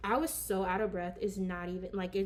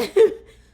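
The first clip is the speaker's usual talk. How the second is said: loud laugh; 11 semitones higher, 19 dB louder than usual. Real, not acted. Laughs at her own joke.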